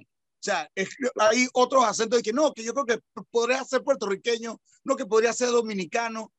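ordinary talk, nothing marked

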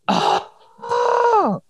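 very high, drawn-out "oh"; a long, high wail of exasperation; exasperated groan. Real, not acted. Host hates torn wrapping paper, exasperated groan.

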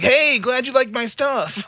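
high, slow, overly sweet; mock oblivious fan. Host mocks the fan's oblivious thanks, high and slow. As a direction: drawl it out cluelessly cheerful. Imitating his oblivious reply.

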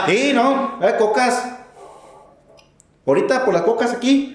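high Oaxacan worker voice; Oaxacan accent. Retold or imitated. Worker offering cocas to the trucker, high Oaxacan accent. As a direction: pitch it up in the Oaxacan lilt. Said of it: "Ay, los oaxaqueños".

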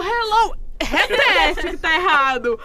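mock English lesson. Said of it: "E eu".

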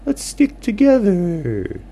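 high, loud owl character; squeaky owl friend. Acted.

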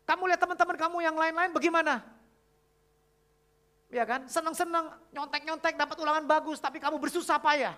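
loud, high-pitched retold telling-off; stern principal scolding. Principal scolding cheating pupils in front of everyone, stern and loud. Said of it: "kepala sekolahnya itu tegas banget".

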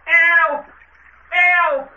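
high, drawn-out calls for help; distressed high wail; cry for help. Acted. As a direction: cry out from the water to be rescued. Man overboard cries for help.

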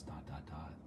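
dot, dot, dot, low and hushed, like a breath; soft meditative dots. Shown as a demonstration. Host leading listeners through the dot meditation, hushed and low. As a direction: let each dot fall away quietly. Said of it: "put all your attention on the way that feels".

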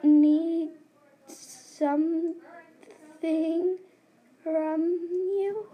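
halting, broken words, pushed out; stammering in fear. Acted. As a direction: stammer it, scared. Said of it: "she stammered in fear".

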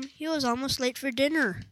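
ordinary delivery

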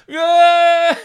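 high, full-voiced roar; mock crowd cheer. Crowd answers mum's promo, high loud cheer. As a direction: whoop like a roused crowd.

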